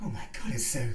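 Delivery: breathless whisper; whispered awe. Acted. Awe feeling his flesh.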